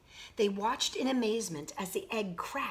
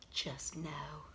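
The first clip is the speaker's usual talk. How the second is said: knowing whisper; 7 semitones lower, 12 dB quieter than usual. Acted. Marilyn's knowing line.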